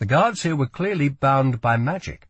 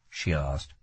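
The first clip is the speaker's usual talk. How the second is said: usual speaking voice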